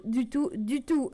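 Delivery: ordinary delivery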